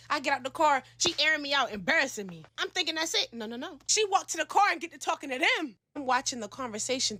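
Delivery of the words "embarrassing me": plain reading voice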